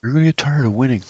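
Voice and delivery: half-hearted boastful Trump brag; Trump impression. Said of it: "my imitation's not that good".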